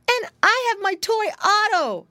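put-on excited little boy showing off; piglet brother. A narrator's reading. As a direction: pitch it up as a proud young pig. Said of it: "said his brother".

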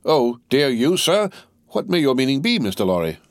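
high, indignant questioning; taken-aback, offended querying; affronted challenge. A narrator's reading. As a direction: demand an explanation, pitch leaping on "sir". Stryver challenges Lorry, taken aback.

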